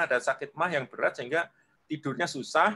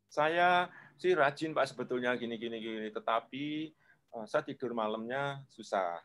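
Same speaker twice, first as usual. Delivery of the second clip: held-back voice; 4 semitones lower, 8 dB quieter than usual. Retold or imitated. Client confiding a sleep problem to his coach, held back and quiet. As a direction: let the words sink and fade as if swallowed. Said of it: "Agak, agak dia tahan".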